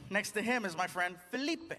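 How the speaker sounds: normal talking voice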